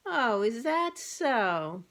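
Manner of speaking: slow, drawn-out doubtful retort; the cat needles the boastful bird; slow mock-impressed cat; sarcastic. A narrator's reading.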